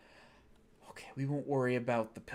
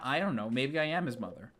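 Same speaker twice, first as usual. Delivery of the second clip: ordinary delivery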